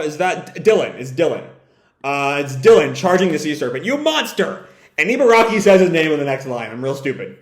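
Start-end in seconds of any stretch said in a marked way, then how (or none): 3.9-4.7 high-pitched cry of outrage; acted, high indignant outburst; outraged cry. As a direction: hurl it as an accusation at an enemy. Acted battle cry at the enemy.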